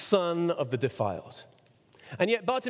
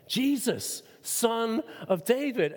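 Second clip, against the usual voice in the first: higher-pitched, drawn-out appeal; retold calling out; 5 semitones higher, much slower than usual. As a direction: call the name slowly, without shouting. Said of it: "what did he shout?".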